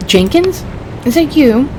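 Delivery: high, sudden surprise; high, surprised call; startled hope. Acted. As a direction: voice jumps up at hearing a familiar name. Scared man hears a familiar name.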